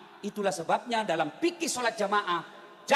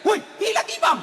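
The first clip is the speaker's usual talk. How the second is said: high, loud shout; mock alarmed yell; 10 semitones higher, 7 dB louder than usual. Boy discovering the imam gone mid-prayer, high loud yell. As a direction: yell it out to the whole row, much higher. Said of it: "langsung teriak".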